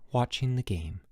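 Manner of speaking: ordinary delivery, nothing marked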